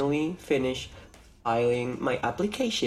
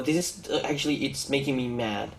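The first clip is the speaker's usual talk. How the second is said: ordinary talk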